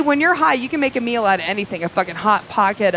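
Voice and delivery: ordinary delivery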